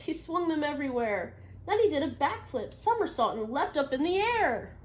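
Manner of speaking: picture-book read-aloud; reading to children. Acted. Teacher reading a story to the class.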